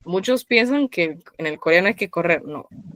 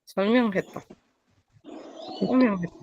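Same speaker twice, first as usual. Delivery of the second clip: "Solmyeon, jeta" in two soft beats; split two and two; 2 semitones higher, 7 dB quieter than usual. Shown as a demonstration. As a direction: softly separate "Solmyeon" from "jeta". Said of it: "Parto la pronunciación de dos en dos".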